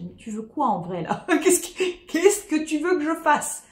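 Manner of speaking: aigu, amusé, entre rires; parler au chat en riant. Retold or imitated. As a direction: interroger gentiment le chat. (en riant au chat)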